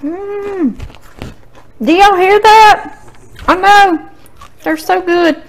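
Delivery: talking while chewing, high and excited; excited chat through a crunchy bite; mouth full. Real, not acted. Tasting crunchy potatoes on camera.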